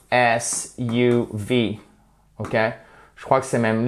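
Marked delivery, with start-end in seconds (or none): 0.1-1.8 slow spelling. Shown as a demonstration. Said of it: "c'est le".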